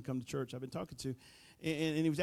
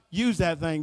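usual speaking voice

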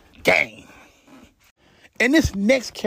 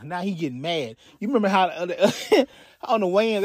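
ordinary delivery

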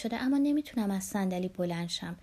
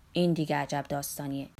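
lower, different timbre for the publisher; a lower, put-on voice for the publisher; publisher's voice; 6 semitones lower than usual. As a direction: voice the exasperated publisher.

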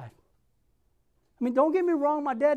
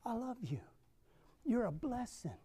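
soft, much quieter, tender reassurance; 13 dB quieter than usual. Shown as a demonstration. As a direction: say it gently to your child. Preacher models words to children.

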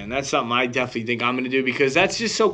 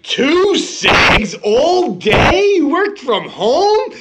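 high, loud, drawn-out disbelief; hammed-up exasperated shouting; mock outraged yell; 9 semitones higher, 6 dB louder, much slower than usual. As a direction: holler incredulously as if telling off a slacker. Retells scolding mom about her smoking.

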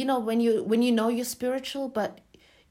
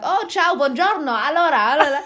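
high, loud, exuberant mock-Italian greeting; playing Italian; 8 semitones higher, 9 dB louder than usual. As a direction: greet them like an effusive Italian. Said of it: "the Italians came and I'm like".

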